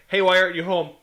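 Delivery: loud teasing call to a passer-by; mock scolding call. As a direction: call out like a nosy reporter.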